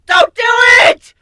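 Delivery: high, loud desperate yell of warning; frantic shout. A narrator's reading. Nightmare bursts in shouting at Griffin to resist. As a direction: scream at him to stop. Tug of war between voices.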